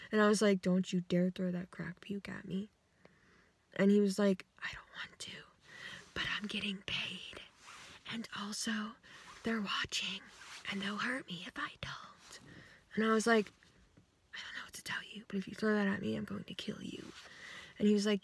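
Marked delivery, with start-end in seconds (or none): 0.6-2.7 hushed, urgent warning; whispered threat. Retold or imitated. Whispering a threat to the guy with crack puke. 4.6-12.4 faint whisper, afraid of being overheard; scared whisper. Retold or imitated. Guy whispering that he's paid and watched. 14.3-17.0 soft, flat whispered warning; whispered threat. Retold or imitated. Whispering a threat back to the guy.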